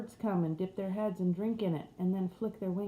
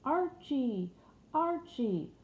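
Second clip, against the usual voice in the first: higher, sweet clear calling; sweeter and clearer; 7 semitones higher, much slower than usual. Acted. Dream voice calling the man's name, sweet and clear. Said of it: "sweeter and clearer than before".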